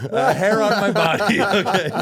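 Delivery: high, loud punchline through laughter; helpless laughing insistence; laughing protest. Real, not acted. Storyteller excusing his boyhood innocence, laughing protest.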